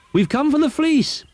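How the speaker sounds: high, loud call across the farmyard. Acted. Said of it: "called Heggity Haggity".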